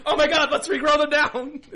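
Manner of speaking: high, loud laughing panic. Retold or imitated. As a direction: blurt it in laughing alarm. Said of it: "And then they're like".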